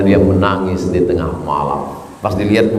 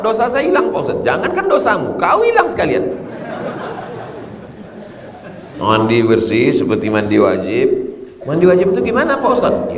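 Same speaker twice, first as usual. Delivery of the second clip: higher, teasing exchange with a naive congregant; comic questioner; 11 semitones higher than usual. Acted. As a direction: play the clueless asker in a higher voice.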